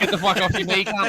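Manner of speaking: high, loud laughing command; laughing, teasing yell; laughing mock order. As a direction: bark it through laughter at a mate.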